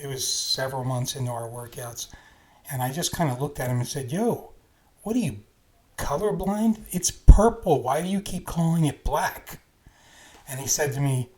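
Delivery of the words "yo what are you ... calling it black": exasperated, higher-pitched questioning; annoyed. Retold or imitated. Teen fed up with his workout friend, annoyed. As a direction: ask it like you are fed up. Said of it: "one day I just was annoyed".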